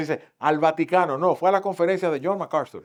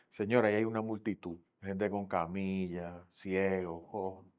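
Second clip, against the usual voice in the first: weary report; 7 semitones lower, 10 dB quieter than usual. Acted. Peter reports crowd at night.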